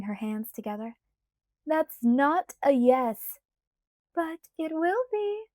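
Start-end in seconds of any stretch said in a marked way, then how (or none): 1.7-3.4 slow, louder, each word weighed; slow louder insistence. A narrator's reading. Bella insisting. 4.1-5.5 higher, slower lilting; sing-song. A narrator's reading. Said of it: "she sang".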